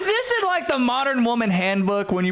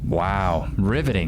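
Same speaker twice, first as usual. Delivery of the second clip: sarcastic; slower than usual. Real, not acted. Mocking boring story.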